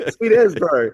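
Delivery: loud hammed-up Kiwi drawl; broad kiwi accent. Kiwi slang after swearing remark.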